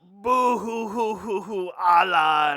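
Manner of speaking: hammed-up wailing, slow and drawn out; fake-sob like a cartoon crybaby; theatrical boo-hooing character voice; Watto. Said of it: "Watto, what's up?".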